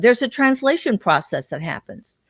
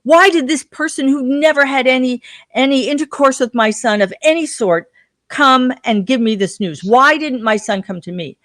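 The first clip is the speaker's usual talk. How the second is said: furious father; 6 semitones higher, 5 dB louder than usual. Retold or imitated. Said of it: "he was furious".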